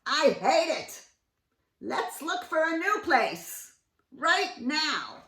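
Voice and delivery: high, loud and slow insistence; bossy wife bird; loud demand. Acted. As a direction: stamp your foot as you say it. Missus Bird complaining.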